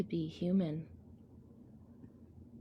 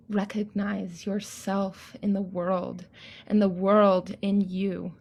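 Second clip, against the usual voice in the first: ordinary delivery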